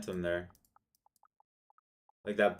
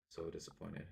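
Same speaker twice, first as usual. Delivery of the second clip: dejected; 9 dB quieter than usual. Real, not acted. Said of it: "That is so disappointing".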